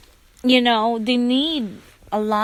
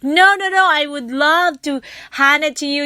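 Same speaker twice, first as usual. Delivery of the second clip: high and louder, warm insistence; insistent offer; 4 semitones higher, 4 dB louder than usual. Retold or imitated. Stranger insisting the narrator take the pouch, high insistent. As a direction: press the gift on her. Woman insisting on giving gift.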